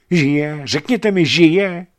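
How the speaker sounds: high, loud, desperate plea; urgent pleading. A narrator's reading. Tamara pleads with the men over the wounded Oleg, high and loud. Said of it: "naléhala Tamara".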